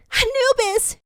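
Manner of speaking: a high, piercing call of his name; anguished call. A narrator's reading. Tikal calling after the dragged-off jackal, high anguished cry. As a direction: cry out for him.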